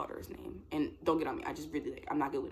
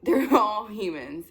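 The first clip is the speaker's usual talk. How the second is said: loud, slow, through laughter; amused and drawn out; laughing; 5 semitones higher, 10 dB louder, slower than usual. Real, not acted. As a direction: laugh as you make the point. Laughs getting back to point.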